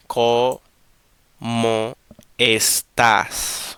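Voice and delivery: cómo estás broken into slow syllables, ending on a strong TÁS; emphasis on estás. Shown as a demonstration. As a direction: spell out cómo estás bit by bit, leaning on TÁS. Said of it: "we got the emphasis here".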